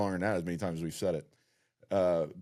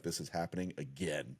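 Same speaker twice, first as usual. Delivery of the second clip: low, soft deadpan; understated disbelief; 4 semitones lower, 9 dB quieter than usual. Retold or imitated. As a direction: drop it low and quiet, in mock disbelief. Acts out their weary shared reaction.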